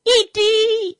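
"Eat" shot up very high; a playful high squeak on "Eat"; squeaky high "Eat". Shown as a demonstration. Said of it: "we need a break".

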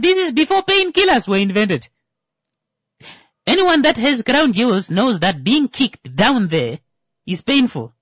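ordinary delivery

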